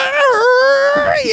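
high shouted ad-lib; hype shout. Acted. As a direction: yell it out like a hype man. Shouted ad-lib during freestyle.

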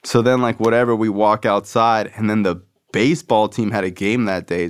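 ordinary delivery

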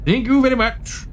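haughty officer. Acted. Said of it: "Herodotus says".

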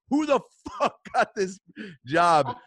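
high, exasperated mock outrage; hard-pressed demand. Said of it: "I just was so hard pressed".

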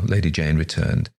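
ordinary delivery, nothing marked

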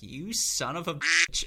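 retold jealous outburst, stretched out; jealous mock cursing. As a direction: draw it out in comic envy. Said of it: "the extreme jealousy I had in that moment".

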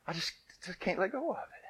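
put-on little girl voice. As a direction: play a small pleading girl. Child voicing her fear.

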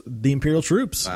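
usual speaking voice

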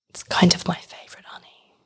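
hushed secret whisper. Real, not acted. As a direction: whisper it so nobody hears. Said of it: "Why are you whispering?".

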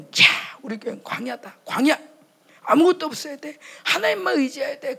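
loud, high proclamation; a pastor thundering that the church is a wilderness; mock preaching. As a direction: declare it like a fiery pastor. Quoting pastor preaching loudly.

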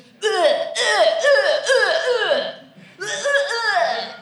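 loud, high grunting ughs; Trump grunts. Retold or imitated. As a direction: grunt like a blustering man. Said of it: "all I hear is just".

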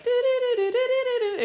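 high-pitched "doo-doo" imitation; eerie jingle noise. Actress marking a weird coincidence, high eerie doo-doos. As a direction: sing out a high, repeating spooky motif. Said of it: "It was like".

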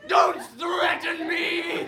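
slow furious demon shout; angry demon. Acted. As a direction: bellow it slowly, like an offended demon. Demon answers a threat.

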